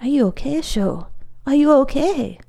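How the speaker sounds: mildly alarmed, a touch breathless. A narrator's reading. Glennie kneels by his fallen friend, breathlessly asking. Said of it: "asked breathlessly".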